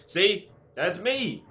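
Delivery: sudden loud, high cry of recognition; excited outburst. Real, not acted. Telling viewers the story is him, excited loud outburst. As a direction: shout it out like you've been caught in the story. Reacting to his own childhood story.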